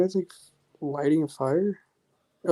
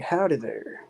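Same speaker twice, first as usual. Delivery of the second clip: demonstrating a Texas howdy; light Texas drawl; Texas accent; 3 semitones higher, 5 dB quieter, slower than usual. Shown as a demonstration. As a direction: say it like a Texan greeting. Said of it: "you go to Texas, you're gonna be like".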